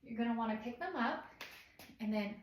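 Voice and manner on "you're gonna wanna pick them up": ordinary talk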